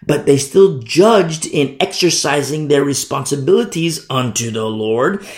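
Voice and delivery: usual speaking voice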